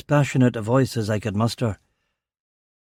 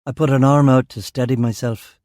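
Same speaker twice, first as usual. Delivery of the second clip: usual speaking voice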